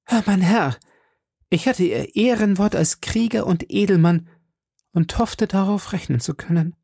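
high, wounded woman's reproach; reproachful. A narrator's reading. Madame Bonacieux holding D'Artagnan to his word, reproachful. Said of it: "im Tone des Vorwurfs".

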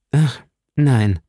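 emphatic sigh of denial; convincing denial. A narrator's reading. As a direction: deny it with full sincerity. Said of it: "in einem Ton, der an die Wahrheit". (überzeugend verneinend)

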